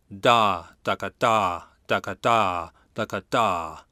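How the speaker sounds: long "Da", short "ka" "da" in a steady trumpet rhythm; scatted trumpet rhythm with a lengthened eighth note; long-short-short syllables. Shown as a demonstration. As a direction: stretch the first syllable, clip the next two. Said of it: "the eighth note has more length than the sixteenth notes".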